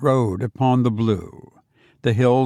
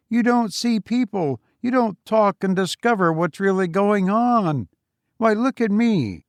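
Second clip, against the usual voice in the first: a higher, self-satisfied voice; Foster's voice; 5 semitones higher than usual. Acted. Boastful visitor lectures his old friend, higher and self-satisfied. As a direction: patronize him kindly while praising yourself, a bit higher.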